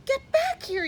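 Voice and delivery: high, loud commanding yell. A narrator's reading. Pip yells at the scattering prairie dogs, high loud yell. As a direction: call the fleeing dogs back. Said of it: "yelled Pip".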